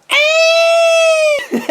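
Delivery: very high, loud mock scream from downstairs; imitated scream. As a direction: shriek like someone caught under a falling tree. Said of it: "and I hear a".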